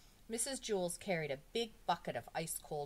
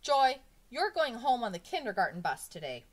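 loud, raised-pitch teacher's scolding; cross teacher laying down the law; angry; 6 semitones higher, 9 dB louder than usual. A narrator's reading. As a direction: hand down a punishment crossly.

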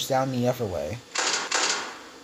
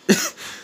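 squeaky laugh. Real, not acted. Laughing at a derpy face.

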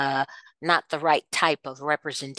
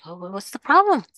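mock careless mother; 6 semitones higher than usual. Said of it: "It's like".

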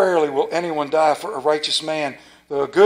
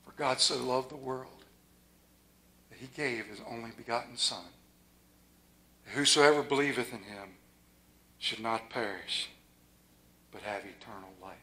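hushed reverent reading; soft scripture reading; 8 semitones lower, 15 dB quieter than usual. Real, not acted. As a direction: read it like a prayer.

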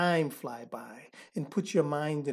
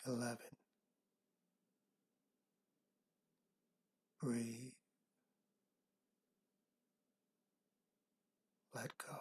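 soft spa whisper; 3 semitones lower, 8 dB quieter than usual. Acted. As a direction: guide them into calm, breath by breath. Leads a counted relaxation meditation.

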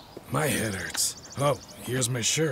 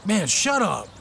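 loud, raised-pitch angry outburst; 9 semitones higher, 4 dB louder than usual. Acted. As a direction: snap back loudly to silence him. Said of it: "Don't get angry, brother".

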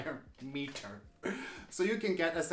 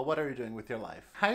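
ordinary delivery

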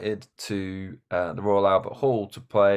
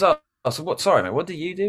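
much higher, bright friendly question; Luke's chirpy voice; 7 semitones higher, faster than usual. Retold or imitated. Retelling Luke chatting to a stranger, lightly high voiced. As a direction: play Luke as a cheerful oblivious fan.

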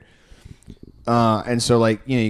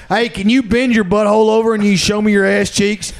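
higher, louder crude mock doctor; crude doctor parody; 8 semitones higher, 5 dB louder than usual. Patient parodying the crude doctor, louder and higher. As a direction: bark out the crude request. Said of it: "I don't need".

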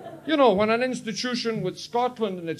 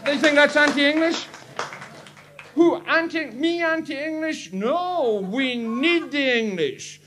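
mock indignant; 11 semitones higher, 8 dB louder, slower than usual. Mock outrage at being anti-English.

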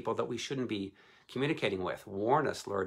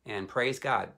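ordinary delivery

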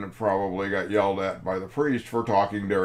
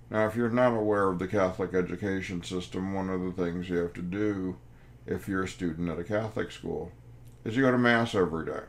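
ordinary talk

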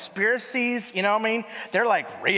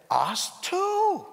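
sing-song sister; 12 semitones higher than usual. Retold or imitated. Mimicking a sweet missionary sister.

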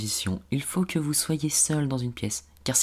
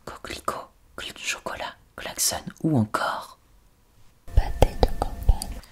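ASMR whisper. Shown as a demonstration. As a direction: breathe the words, no voice. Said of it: "on utilise des mots déclencheurs".

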